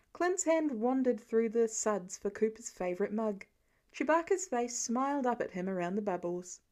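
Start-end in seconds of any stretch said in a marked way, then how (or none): none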